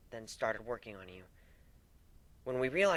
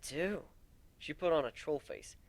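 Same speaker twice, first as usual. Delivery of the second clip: ordinary delivery, nothing marked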